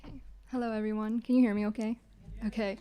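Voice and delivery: normal talking voice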